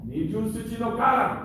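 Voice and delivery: high, loud Japanese drill call; calling out commands. Real, not acted. Sensei naming the exercise, loud commanding call. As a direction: announce the form to the whole room.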